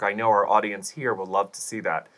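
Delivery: ordinary delivery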